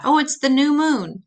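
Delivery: ordinary talk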